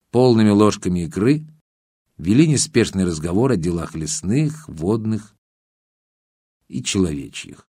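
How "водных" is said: plain reading voice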